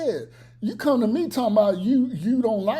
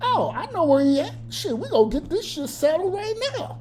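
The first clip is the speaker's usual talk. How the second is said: mock hothead threat; 9 semitones higher, faster than usual. Acting out a hothead ready to fight.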